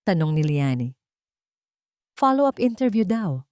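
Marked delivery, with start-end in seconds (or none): 2.2-3.5 high, mildly stunned report; dazed. A narrator's reading. Ara tells her friend about the call, mildly dazed. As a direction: repeat the news as if lightly stunned. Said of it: "tulalang sabi ni Ara".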